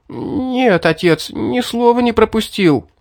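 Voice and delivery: high, shaky teeth-chattering voice; words jolting through chattering teeth. A narrator's reading. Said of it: "клацая зубами".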